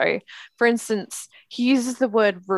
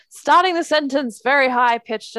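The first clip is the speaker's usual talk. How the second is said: high, louder opening, sing-out reading; pitched up like a performative narrator; high-pitched start; 5 semitones higher, 4 dB louder than usual. Retold or imitated. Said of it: "seem to take the tone of".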